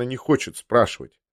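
ordinary delivery

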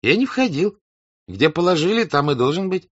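high, defensive servant protesting; the servant Lavrushka denies going in, high and defensive; servant's voice. Acted. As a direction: lift the voice high as the accused servant.

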